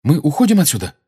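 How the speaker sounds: put-on keen young questioner; eager boy. A narrator's reading. Harry eagerly asks about leaving.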